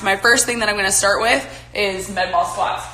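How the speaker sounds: ordinary talk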